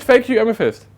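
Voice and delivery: a hint of the high caller voice; Gerd voice. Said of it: "He said".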